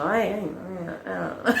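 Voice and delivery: usual speaking voice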